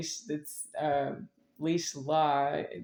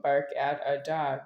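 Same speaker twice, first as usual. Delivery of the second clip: ordinary talk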